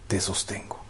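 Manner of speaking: a breathy unvoiced whisper of comfort; whispered promise. Preacher voicing God to anxious parents, whispering. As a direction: hush it like God speaking close. God's voice answering the fear.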